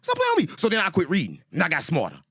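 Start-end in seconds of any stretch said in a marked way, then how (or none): 0.0-0.5 squeaky high indignant protest; mock outrage. Complaining to the Bible writers in a high mock-indignant squeal. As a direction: fling it up high like you're offended.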